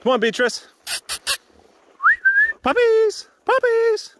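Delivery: high, loud call to the dogs; yelling. Real, not acted. Calling puppies to come along on a walk, yelling high. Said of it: "Sorry for yelling".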